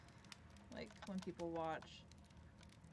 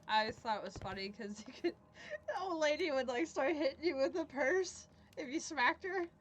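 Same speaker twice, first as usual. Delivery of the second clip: laughing; 7 semitones higher, 7 dB louder than usual. Real, not acted. Funny GTA memory, laughing.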